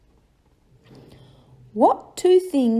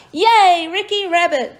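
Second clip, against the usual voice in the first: excited cheer; 7 semitones higher, 5 dB louder than usual. Acted. Cheering the story title.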